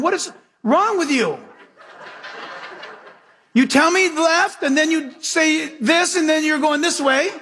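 fed-up driver yelling at the machine; exasperated yell. Retold or imitated. Yelling at the GPS.

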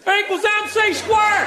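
high, drawn-out Southern holler; hammed-up Einstein with a thick Southern accent; harsh Southern accent. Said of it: "had a really harsh Southern accent".

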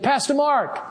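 calling up high from a balcony; retold yell. Producer calls the pastor from the balcony, mild mock yell. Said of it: "he yelled down at me".

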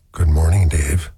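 low, calm, creepy computer greeting. Retold or imitated. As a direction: imitate a creepily calm movie computer, pitched well down. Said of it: "Always with this calm little voice".

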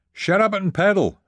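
high, loud angry command; screamed order. A narrator's reading. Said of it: "I screamed".

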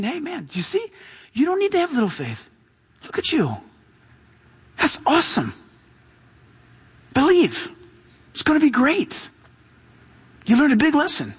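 encouraging Jesus. Acted. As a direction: lift the voice to encourage him. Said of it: "Jesus is saying".

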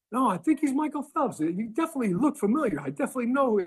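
high-pitched imitation of a convinced onlooker; mock someone sure they've spotted a celebrity; insistent stranger. Acts out insistent stranger recognizing him.

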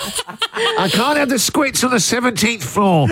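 mock calling in sick to the boss; fake sick-day voice. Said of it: "This is the voice you do when you call your boss".

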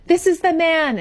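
high accusing cry from the witness. Acted. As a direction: read it as a dramatic outcry, pitched well up. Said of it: "Abigail Williams cries out".

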